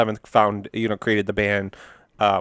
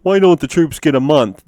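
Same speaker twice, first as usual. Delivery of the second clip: higher, louder dopey complaint; Homer; 5 semitones higher, 5 dB louder than usual. Acted. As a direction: play a dim cartoon dad protesting. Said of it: "Homer's got".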